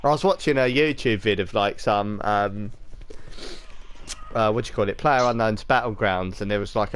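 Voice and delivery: ordinary talk, nothing marked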